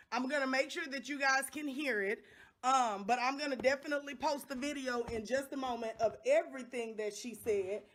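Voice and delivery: ordinary delivery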